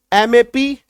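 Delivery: normal talking voice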